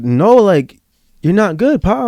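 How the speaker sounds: high-pitched motherly contradiction; knowing, insistent correction; Mama Maria. Retold or imitated. Voices Mama Maria contradicting him.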